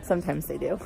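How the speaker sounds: chuckling through the words; laughing. Real, not acted. Joking about Home Depot.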